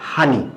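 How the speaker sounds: হানি with an h sound in place of the p; হানি spoken as Noakhali dialect for পানি; Noakhali pronunciation. Shown as a demonstration. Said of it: "নোয়াখালীতে উচ্চারণ করা হয়".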